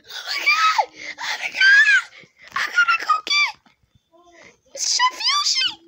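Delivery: very high panicked squeal; squeaky voice. Pretend. Boy whose voice went wrong, squealing very high. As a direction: shriek it way up high like your voice broke. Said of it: "my voice".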